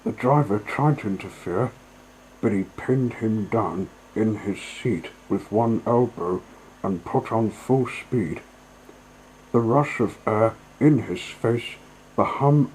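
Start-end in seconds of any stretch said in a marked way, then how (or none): none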